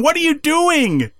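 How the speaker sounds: high, drawn-out imitation of screaming; mock scream. Abandoned wrestler calling to his partner walking away, mock scream. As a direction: plead after him in a high, slow mock yell. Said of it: "He's, like, screaming for him".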